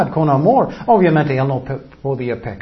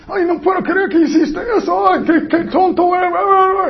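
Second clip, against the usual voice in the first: mock scolding in a much higher, put-on voice; a hammed-up higher voice scolding someone in disbelief; shrill judgmental scold. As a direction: squeak out the shocked judgment like a busybody.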